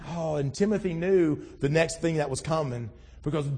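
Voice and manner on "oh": ordinary delivery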